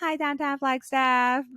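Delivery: high, slow sing-song greeting; cheerful hello. Retold or imitated. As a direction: greet the town like an old friend. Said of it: "swing by everything and say hello".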